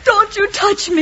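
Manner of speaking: high, loud, drawn-out rebuff; tearful anger. Acted. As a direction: push him away, upset and crying. Crying niece rejects uncle.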